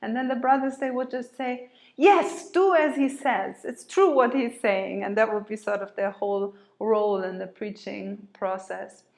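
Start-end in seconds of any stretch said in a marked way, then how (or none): 2.0-5.0 high, loud, emphatic backing; loud eager agreement. Acted. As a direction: shout your support to the crowd. Brothers loudly backing Francis's preaching.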